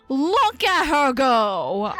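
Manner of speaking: slow, stretched-out gushing cheer; admiring cheer. Real, not acted. Streamer gushing over new character art, admiring cheer. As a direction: draw out each word in delight.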